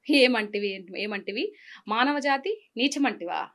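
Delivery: mythological film oratory, hushed opening building to full voice; theatrical Telugu declamation; Junior NTR. Acted. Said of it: "ఈ junior NTR dialogue వచ్చా".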